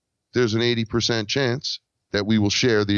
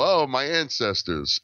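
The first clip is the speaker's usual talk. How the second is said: teasing mockery; 5 semitones higher, slower than usual. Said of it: "He was teasing me".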